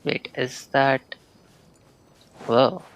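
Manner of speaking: ordinary delivery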